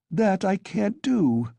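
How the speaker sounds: high, slow, offended refusal; offended cry. A narrator's reading. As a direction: bristle at the insult. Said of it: "the girl cried, offended".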